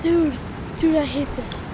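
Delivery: loud panicked pleading. Real, not acted. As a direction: panic under your breath, then loud. Panicking in chase.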